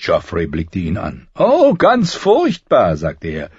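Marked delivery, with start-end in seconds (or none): 1.3-3.0 high, slow, loud sarcasm; sarcastic fear. A narrator's reading. Prince answering a taunt about fear, sarcastic. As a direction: draw out the mock dread, pitched way up.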